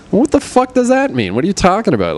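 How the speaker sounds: high, disbelieving outburst; incredulous. Real, not acted. Projectionist baffled by critic's complaint, incredulous.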